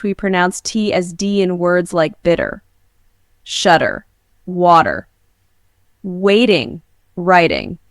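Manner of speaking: American accent, T flapped to D in "water" and dropped in "twenty"; American accent: "bitter" like "bidder"; American accent T demo. Shown as a demonstration. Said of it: "In American English".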